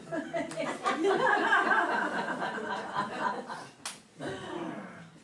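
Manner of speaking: loud, high burst of laughter; big laugh. Real, not acted. Reader cracking up at the Trump quip, loud laugh.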